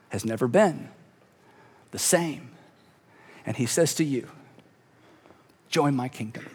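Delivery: low, slow, heavy pauses; hushed solemnity. A narrator's reading. Preacher on the king giving his life, hushed and grave. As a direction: let it sink almost to a whisper at the end.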